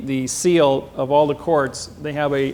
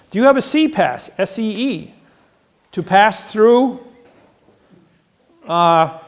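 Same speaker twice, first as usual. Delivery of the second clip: interrogating the guards, raised and high; loud interrogation; 3 semitones higher, 5 dB louder than usual. Retold or imitated. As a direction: grill them. Interrogating the marshals.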